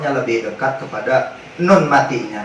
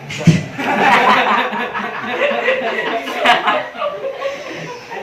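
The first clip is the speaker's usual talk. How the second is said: long laugh; 12 semitones higher than usual. Real, not acted. Teacher bursts out laughing.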